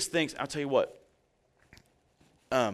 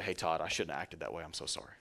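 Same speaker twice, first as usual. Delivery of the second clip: low, much softer, humbled; soft apology; 7 semitones lower, 9 dB quieter than usual. Retold or imitated. Electrician quietly apologizing to his boss Todd. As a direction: quietly own the mistake to the boss. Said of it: "I'd say".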